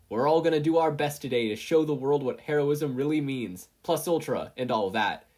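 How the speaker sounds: a lightly voiced All Might; mock hero pep talk; bad All Might impression. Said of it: "in a bad All Might impression".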